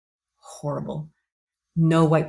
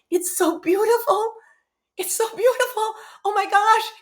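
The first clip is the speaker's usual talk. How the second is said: awed serenity; 4 dB louder than usual. Retold or imitated. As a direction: marvel at the peace beyond. Near-death experience, floating above.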